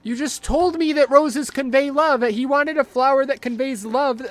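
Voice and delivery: loud, high-pitched complaint; exasperated protest. Real, not acted. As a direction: argue back loudly at the contradiction. Arguing back at the game.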